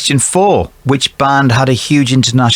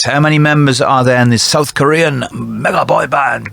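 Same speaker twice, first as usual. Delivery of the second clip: ordinary delivery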